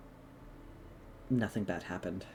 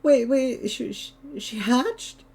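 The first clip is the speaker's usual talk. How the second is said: tearful disbelief; 11 semitones higher than usual. Acted. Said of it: "and he's just like".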